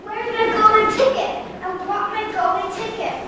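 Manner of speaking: high, far-off demanding cry; distant screaming demand. Acted. Veruca demands her ticket before entering, a distant high cry. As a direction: call out high from a distance, insistently. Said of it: "I was screaming at".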